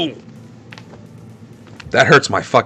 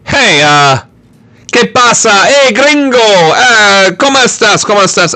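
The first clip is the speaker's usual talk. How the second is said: higher, hammed-up Mexican accent; high-pitched joke Mexican accent; mock Mexican accent; 8 semitones higher than usual. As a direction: greet in a put-on Mexican accent. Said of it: "The university asked you".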